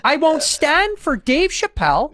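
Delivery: high, loud, drawn-out indignation; slow, strained high-pitched protest; Jordan Peterson. Acted. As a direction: protest loudly, stretching each word up high. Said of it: "Jordan Peterson?".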